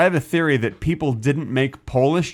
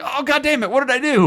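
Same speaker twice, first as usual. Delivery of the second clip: startled pain; 10 semitones higher than usual. Retold or imitated. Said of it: "and you're like".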